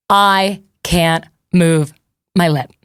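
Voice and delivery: very slow, word-by-word delivery; slow emphatic. Real, not acted. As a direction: space out each word for emphasis. Emphasizing that her lip is frozen.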